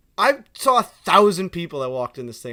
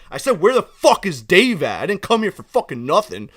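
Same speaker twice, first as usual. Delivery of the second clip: louder, emphatic acted anger; confrontational customer; 3 semitones higher, 8 dB louder than usual. Irate customer face to face with the counter worker, angry. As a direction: imitate an irate customer squaring up. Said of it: "face to face and goes".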